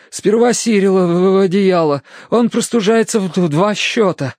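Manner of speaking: high, stammering urgency; shivering. A narrator's reading. Terence wants his dog warmed first, shivering. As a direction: insist through chattering shivers. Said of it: "ответил он дрожа".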